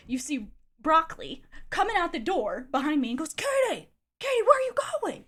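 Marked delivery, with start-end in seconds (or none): 3.3-5.2 high, loud frantic calling after her; imitating him shouting from the doorway; mock frantic yell. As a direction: yell her name like a panicked guy.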